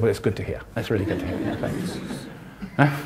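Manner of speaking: quiet mock approval; dry sarcasm. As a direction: say it flat and dry, ending on a breathy laugh. Sarcastic reply to the official.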